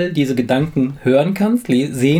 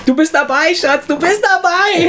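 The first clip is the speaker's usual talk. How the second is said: high falsetto, slow and syrupy; hammed-up lovey falsetto; mock sweet reassurance; 3 dB louder than usual. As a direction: coo it like a doting partner.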